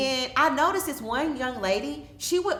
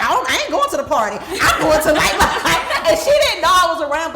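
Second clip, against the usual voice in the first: loud, fast and laughing; laughing while quoting her; bubbling laughter through the words; 7 semitones higher, 10 dB louder than usual. Real, not acted.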